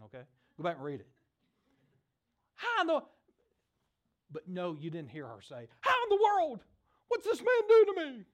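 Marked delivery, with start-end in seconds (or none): 2.5-3.0 mock panic. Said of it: "She wasn't freaking out". 5.8-8.2 high, loud hammed-up panic; a pretend widow losing it, shrieking high; mock freaking out. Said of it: "She wasn't freaking out".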